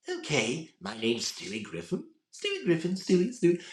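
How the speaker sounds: raspy cartoon voice leaping high; Stewie Griffin with rasp. Retelling to the host his raspy Stewie test for a friend, character impression. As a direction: let the name jump up high through the rasp. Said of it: "And it was with the rasp".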